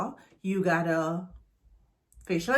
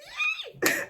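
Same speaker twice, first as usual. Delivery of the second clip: high shrieking laugh. Real, not acted. Laughing at herself.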